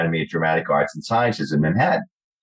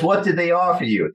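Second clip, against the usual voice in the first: higher put-on agent voice; imitating the agent; 8 semitones higher than usual. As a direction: play the eager agent. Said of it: "He goes".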